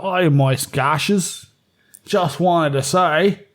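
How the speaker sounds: very slow drawn-out Australian country drawl; hammed-up slow Australian accent. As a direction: stretch every word like a slow Aussie bloke. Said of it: "he's real slow talker".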